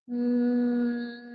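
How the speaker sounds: long low hum through closed lips; humming bee sound. Shown as a demonstration. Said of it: "make the humming sound".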